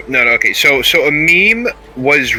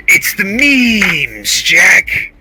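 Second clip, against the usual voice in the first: slow, drawn-out villain line; villain quote; 5 semitones higher, much slower than usual. Retold or imitated. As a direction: drag out memes like a boss. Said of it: "the way he's just like".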